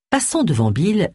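ordinary delivery, nothing marked

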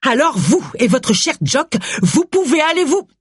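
high, indignant telling-off; angry outburst. Acted. Agatha starts cursing Gustave and Jock, high angry outburst. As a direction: lash out, pitch climbing until cut off. Agatha rages, about to curse.

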